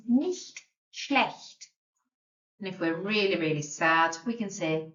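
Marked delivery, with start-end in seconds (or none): 0.1-1.7 "nicht schlecht" with a throaty ch; careful pronunciation. Shown as a demonstration. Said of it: "quite difficult to get your mouth around".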